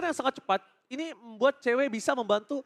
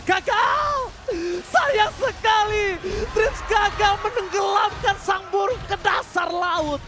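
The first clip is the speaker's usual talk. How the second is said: dramatic drawn-out call; 7 semitones higher, slower than usual. Real, not acted.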